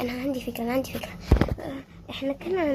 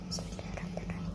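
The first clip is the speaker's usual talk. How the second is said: barely audible whisper; whispered basmala before acting; whispered prayer; 14 dB quieter, faster than usual. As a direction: breathe the prayer.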